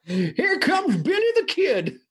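put-on very high, excitable old prospector. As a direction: cry out like a hammed-up Western old-timer. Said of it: "It's the old prospector".

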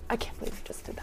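a breathless whisper of disbelief. Retold or imitated. Customer at the hand sanitizer whispers to himself in disbelief. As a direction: whisper it in shock under your breath.

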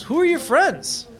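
high, swooping question; curious Jorge. Acted. Jorge asks a rival about the group, curious. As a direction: lift the voice in curiosity. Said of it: "And he goes".

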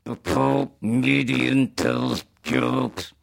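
very slow synthesized speech; flat, slow computer voice; Stephen Hawking voice. Retold or imitated. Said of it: "his comedy, it would be like".

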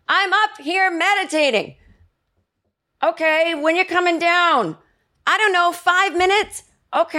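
high, strained holler between floors; yelling down stairs. Retold or imitated. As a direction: call out as if to someone downstairs. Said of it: "if I then yell down the stairs".

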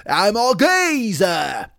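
high, very slow, broad mock Cockney. Singer after punching the guitarist, hammed-up Cockney cry. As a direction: bellow it as a dim Cockney bruiser, hammed up. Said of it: "why [name] talks like that".

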